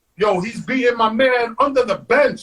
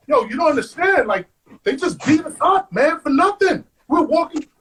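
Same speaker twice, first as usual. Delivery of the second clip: higher-pitched, retold plea of injustice; protesting plea; 7 semitones higher than usual. Defendant telling the judge about a beating, protesting. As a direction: plead your case, voice pitched up.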